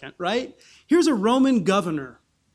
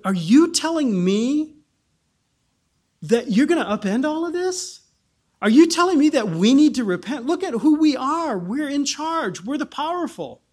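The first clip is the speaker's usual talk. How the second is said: higher, louder, incredulous challenge; indignant governor; 6 semitones higher, 4 dB louder than usual. Acted. Roman governor confronting prisoner Paul, indignant and loud. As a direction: Festus scoffing at Paul. Festus voiced scolding Paul.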